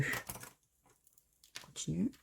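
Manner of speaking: slow, soft whispered aside. Real, not acted. Moving on quietly, whispered to herself. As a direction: whisper it, drawn out.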